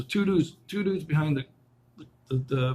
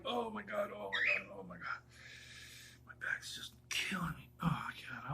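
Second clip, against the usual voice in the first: hushed groaning, dropping into whisper; real back pain; 3 semitones higher, 13 dB quieter than usual. Real, not acted. Man whose back is killing him, quietly pained. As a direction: wince through it under your breath.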